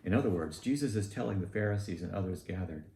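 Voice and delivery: ordinary talk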